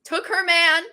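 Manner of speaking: high-pitched gleeful gossip, voicing your own reaction; mock-scandalized and delighted; gossipy reaction. Acting out her gleeful reaction.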